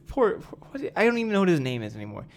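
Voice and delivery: ordinary talk, nothing marked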